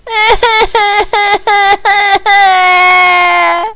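long, high acted wail; hammed-up crying, drawn out and loud; loud bawling. Candy crying enough to wake the orphanage, loud bawling. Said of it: "The crying woke up the whole orphanage".

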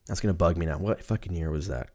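ordinary talk, nothing marked

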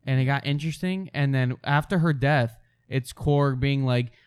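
ordinary talk

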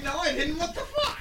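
high, loud baffled protest; mock indignant denial. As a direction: protest as if wrongly accused. Acting out the denial.

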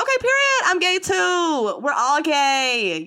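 high, loud, gleeful declaration; giddy kid. Retold or imitated. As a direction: celebrate like a clueless excited child.

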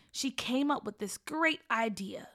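usual speaking voice

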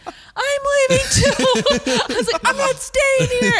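high, loud frantic yelling; panicked shout. Retold or imitated. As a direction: bolt from the scary doll.

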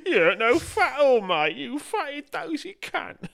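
high, loud, slow put-on voice; hammed-up insulting Cockney; mock insults. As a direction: sneer the insults in a silly voice.

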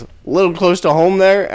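mock needling jab, high and loud. Girl at work roasting the teller, needling. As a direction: pitch it up and poke fun.

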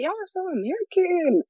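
high, slow teasing sing-song. Retold or imitated. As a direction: drag it out to tease. Teasing American friends.

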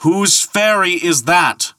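high, slow carrying call; insistent shouted question. Acted. Thor repeating his question to the ferryman, calling insistently. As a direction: call it out slowly across water. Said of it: "repeated Thor".

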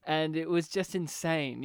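ordinary talk, nothing marked

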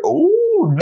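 very high, loud ooh; surprised ooh. Real, not acted. Host reacting to guest loving spicy food, very high squeal. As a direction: squeal with delight.

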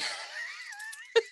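high squeaky burst of laughter; real giggle. Real, not acted. Laughing at the gossip.

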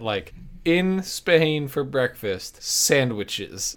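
ordinary talk, nothing marked

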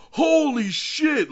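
very high, loud and slow stunned exclamation. Retold or imitated. Reacting to the shooting on screen, stunned and drawn out. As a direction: stretch it out in shock.

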